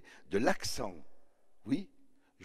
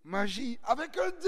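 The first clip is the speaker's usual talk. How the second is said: high, giddy cry of "magie"; giddy exclamation; 12 semitones higher than usual. Retold or imitated. The girl crowing about the word magie, high and giddy. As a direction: squeal it out giddily. Girl's gushing.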